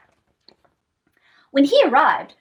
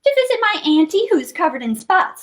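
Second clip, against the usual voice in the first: put-on quick little-girl reply, starting high; Little Red; 6 semitones higher, faster than usual. A narrator's reading. Little Red answers the lion, quick and innocent. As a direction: answer fast and high-pitched. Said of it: "said Little Red".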